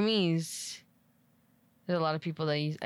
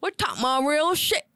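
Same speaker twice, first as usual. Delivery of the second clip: put-on louder, higher southern accent; mock southern accent; 8 semitones higher, 6 dB louder than usual. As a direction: belt it out in a hammed-up southern accent. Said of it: "It's, like, more southern".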